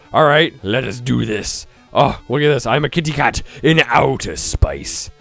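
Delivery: ordinary delivery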